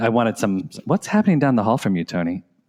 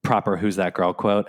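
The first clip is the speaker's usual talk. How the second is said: ordinary talk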